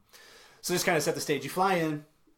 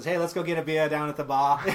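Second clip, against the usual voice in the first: jokey, louder mock Australian bloke; Australian accent; 4 semitones higher, 9 dB louder than usual. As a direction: ham up an Aussie mate's invitation to the pub. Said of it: "my Australian accent".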